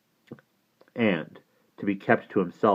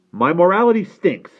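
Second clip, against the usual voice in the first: raised, exasperated comeback; father retorting; 11 semitones higher, 10 dB louder than usual. Acted. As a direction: fire back at your nephew, higher. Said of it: "said my father".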